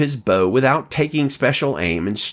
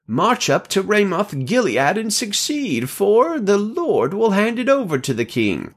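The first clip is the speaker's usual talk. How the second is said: high-pitched, rousing declaration; prophets' proclamation; 10 semitones higher than usual. A narrator's reading. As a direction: proclaim it high, urging the king on. Prophets urging the king to war.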